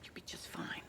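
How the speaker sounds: hushed, near-whispered comfort; soft farewell encouragement; gentle reassurance. Acted. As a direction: reassure very softly. Teacher reassures student at parting.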